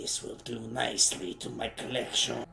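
mimicking a sinister game voice; lightly voiced creepy villain. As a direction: echo the creepy line in mock menace. Mimicking the game's creepy character.

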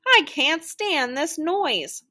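long-drawn storybook complaint; exasperated complaint. A narrator's reading. Bothered character covers ears, protesting.

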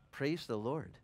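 ordinary talk, nothing marked

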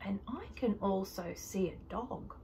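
whispering. Real, not acted. Whispered aside.

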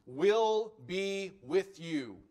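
"will" drawn out very slowly, stressed; emphatic will. Shown as a demonstration. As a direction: stretch "will" out slowly, firmly. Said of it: "The emphatic".

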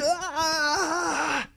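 long high-pitched "aah" scream; mock holler. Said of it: "these guys just start hollering".

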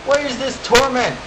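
loud, high cry of pain; anguished shout. Real, not acted. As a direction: yell out in agony. Player electrocuted by hazard.

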